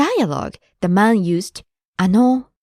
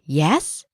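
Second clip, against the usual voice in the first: "Yes" tipped upward as a question; inquiring "Yes", lifted at the end; rising question; 5 semitones lower than usual. Shown as a demonstration. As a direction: answer "Yes" as if asking what is wanted. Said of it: "If you say it with rising intonation".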